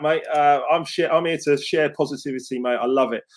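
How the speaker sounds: ordinary talk, nothing marked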